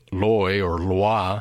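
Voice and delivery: slow loy then loi in French; loy or loi said slowly, sounding out the French loi. Shown as a demonstration. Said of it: "in the second syllable".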